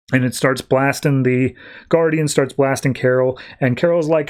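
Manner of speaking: ordinary delivery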